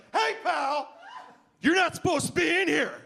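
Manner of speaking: high, forceful challenge from a bouncer; bouncer confrontation. Acted. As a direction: call him out like an indignant bouncer. Acted bouncer confronts the comic.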